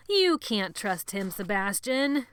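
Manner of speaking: loud, slow, insistent warning; urgent warning. A narrator's reading. Benny urging Sebastian slowly and loudly.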